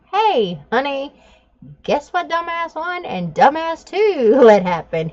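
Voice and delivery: fake-sweet, laughing through the anger; mock sweet sarcasm. Calling husband at work, fake-sweet and laughing.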